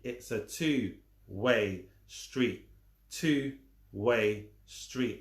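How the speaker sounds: two-way drawn out slowly; slow drilled repeat; very slow emphasis. Shown as a demonstration. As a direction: stretch two-way street word by word. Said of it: "I'm gonna repeat it".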